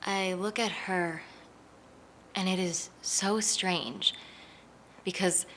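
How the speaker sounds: soft, slow and halting; softly wondering, drawing the words out; tender wonder. Real, not acted. Looking at a woman like the late mother, moved.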